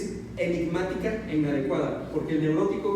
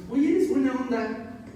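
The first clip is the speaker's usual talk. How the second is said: higher, bright offhand praise; friendly compliment; 7 semitones higher than usual. Retold or imitated. Someone telling you you're cool, higher and offhand. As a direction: toss out a casual compliment. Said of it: "alguien te dijo".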